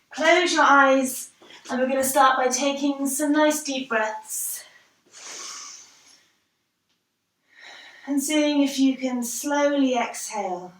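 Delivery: unhurried relaxation cueing; guided breathing. Real, not acted. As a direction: lead them calmly through each breath, leaving space. Leads a guided breathing exercise.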